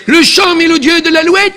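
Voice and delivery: ordinary talk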